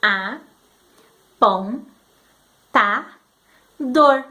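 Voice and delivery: apontador split into A, pom, tá, dor with pauses; counting syllables. Shown as a demonstration. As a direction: count each syllable slowly. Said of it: "fala contando pra mim". (sílabas separadas com pausas, contando)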